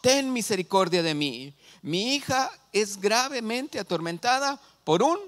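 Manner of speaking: imitated crying out. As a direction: plead as a desperate mother, read aloud with force. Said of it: "le estaba gritando".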